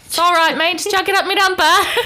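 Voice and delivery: hammed-up Australian girl's voice; mock Australian accent. Host quotes girls in Australia in a mock Australian accent. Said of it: "girls in Australia, when they're on their period, they say".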